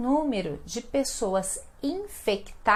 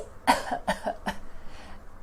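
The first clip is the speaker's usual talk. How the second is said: breathy mock cough; demo cough. Shown as a demonstration. Teacher acting out tosse for students, mock cough. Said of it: "As pessoas têm tosse".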